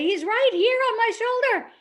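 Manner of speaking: high, loud mock-delighted announcement; imitating an excited father; excited dad. Dad proudly presents invisible bird.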